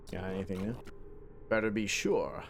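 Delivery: low, soft British NPC voice; lightly British, quietly teasing; a hint of a British tempter; British accent. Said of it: "kinda like a British accent".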